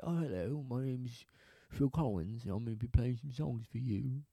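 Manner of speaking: polite British accent, quietly put on. British singer greeting his audience, soft refined British accent. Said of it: "he's much more high class than that".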